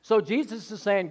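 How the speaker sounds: normal talking voice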